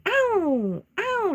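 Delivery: very high whimpering yelps; high mock dog yelps; imitated dog yelp. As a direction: cry like a dog stuck behind a door. Imitating trapped dog's cry.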